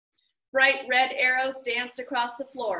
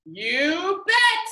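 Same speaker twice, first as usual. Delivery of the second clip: higher and louder, big hearty boom; 7 semitones higher, 6 dB louder than usual. A narrator's reading. Game host answering the bookish girl, booming. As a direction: fill the room with it. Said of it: "boomed Mister Lemoncello".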